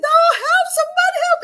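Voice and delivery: high, loud mock cry for help; scared cry for help. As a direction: squeal for help like a frightened kid. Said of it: "I was so scared".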